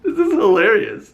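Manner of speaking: high, loud laughing. Real, not acted. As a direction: still cracking up. Still laughing.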